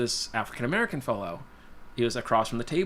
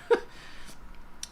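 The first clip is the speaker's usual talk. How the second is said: breathy laugh; 4 dB quieter than usual. Real, not acted. Laughs at the absurdity.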